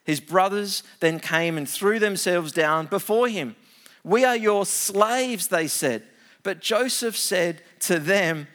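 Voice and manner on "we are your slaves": slow, raised, begging cry; pleading slaves. Acted. Brothers prostrate before Joseph, slow begging cry. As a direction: throw yourself on his mercy.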